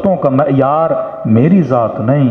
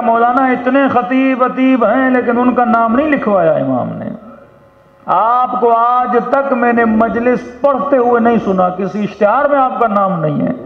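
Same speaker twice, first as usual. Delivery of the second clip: high, ringing preacher's delivery; impassioned retelling; 10 semitones higher than usual. Retold or imitated. Dreamer telling the Maulana his name is in Hussain's diary, impassioned. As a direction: build the address on a sustained high pitch. Acts out astonished address to Maulana.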